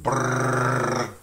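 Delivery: long, loud tongue-rolled brrr; sustained raspberry-like rolling R; rolled R trill. Shown as a demonstration. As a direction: trill it like a kid playing with toy cars. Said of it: "I used to tell people".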